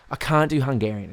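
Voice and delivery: ordinary talk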